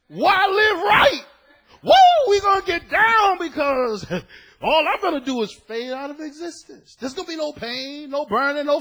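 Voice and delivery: preacher mocking the no-hell crowd; mocking carefree. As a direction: ham up a party attitude.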